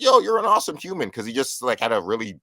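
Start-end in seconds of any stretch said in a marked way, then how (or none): 0.0-1.1 high re-enacted call to the stage; shouted praise. Fan shouting to the performer after his nice story, shouted praise. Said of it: "just shouted out. I was like".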